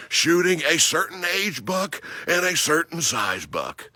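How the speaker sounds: impassioned outdoor sermon; fired-up preaching. Acted. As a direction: preach it to every hunter out there. Preaching to hunters.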